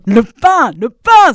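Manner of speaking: loud, high cries of craving; mounting impatient shout; impatient exclamation. A narrator's reading. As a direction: burst out, unable to wait any longer. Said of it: "s'exclama le loup qui n'en pouvait plus".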